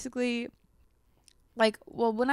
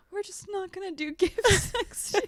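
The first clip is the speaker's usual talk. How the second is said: higher, laughing imitation of a fed-up mom; exasperated mom; 10 semitones higher than usual. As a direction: play mom throwing up her hands. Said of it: "she's like".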